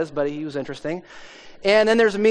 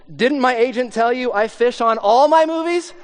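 higher, louder mock indignation; indignant comeback; 6 semitones higher, 5 dB louder than usual. Actor defending fishing on a movie set, indignant. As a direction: deliver it like an offended film star.

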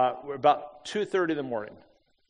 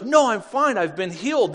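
excited exclaiming; 5 semitones higher, 3 dB louder than usual. Retold or imitated. Healed man retold, exclaiming.

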